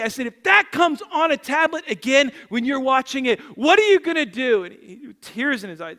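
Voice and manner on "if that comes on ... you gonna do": acted loud, high questioning; retold pleading. Father asks son what he will do next time, pleading. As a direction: press the child for an answer, forcefully. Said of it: "I literally started pleading with him".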